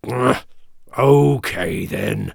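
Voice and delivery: short grunt, then slow assent; slow, grunted troll reply. A narrator's reading. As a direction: give in grudgingly as a troll. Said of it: "said the troll with a grunt".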